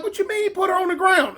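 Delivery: high-pitched affronted question; imitated homegirl in disbelief; acted indignant objection. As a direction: challenge an outrageous order.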